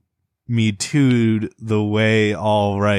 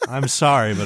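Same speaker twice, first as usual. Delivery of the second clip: usual speaking voice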